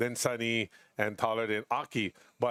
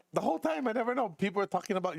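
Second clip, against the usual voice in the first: much higher, mock-amazed; retold amazement; 8 semitones higher, faster than usual. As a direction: squeak it out in disbelief. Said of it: "I was like".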